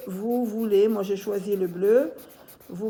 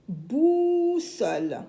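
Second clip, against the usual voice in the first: stretched "bou" in "boussole", raised pitch; slow, higher sounding-out of "boussole"; syllable by syllable; 5 semitones higher, much slower than usual. Shown as a demonstration. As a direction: hold the first vowel of "boussole" long and high.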